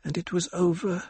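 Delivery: lightly voiced little girl, high and slowed; half-lisping child. A narrator's reading. Aliyah telling her mother, lightly childlike and high. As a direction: tell it like a small child. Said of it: "half-lisping voice of her daughter".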